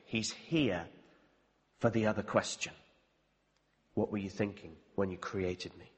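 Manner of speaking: soft, low, awed inner voice; almost breathe the words in awe; hushed realization. Retold or imitated.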